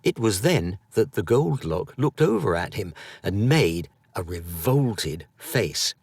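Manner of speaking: plain reading voice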